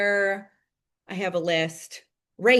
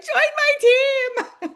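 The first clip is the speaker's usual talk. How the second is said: very high, louder and drawn out very slowly; hammed-up psycho saleswoman; mock psycho recruiter; 6 dB louder, much slower than usual. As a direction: ham up an over-eager crazy pitch. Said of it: "be the psycho one that's like".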